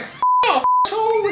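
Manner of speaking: very high mock girly brush-off; mock Betty snapping. Betty tells the harasser off, in a very high mock girly squeal. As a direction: squeal it back at him, hammed up. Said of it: "He's like".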